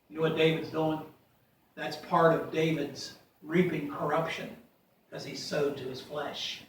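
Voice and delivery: usual speaking voice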